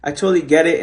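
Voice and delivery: ordinary talk, nothing marked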